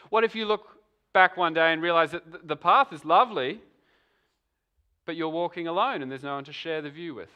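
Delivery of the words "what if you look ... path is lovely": normal talking voice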